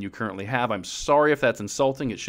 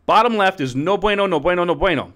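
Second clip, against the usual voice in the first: emphatic no bueno; 5 semitones higher, 5 dB louder than usual. Pretend. Emphatic verdict on bad quadrant.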